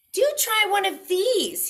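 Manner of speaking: high, loud call from the tree. Acted. Pig in the tree calling to the wolf, high and loud. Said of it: "he called down".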